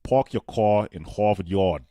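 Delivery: slow, loud attempt at a Boston accent; broad, slow Massachusetts accent. Shown as a demonstration. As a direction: try a drawn-out Boston accent. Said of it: "then do it in a mass accent".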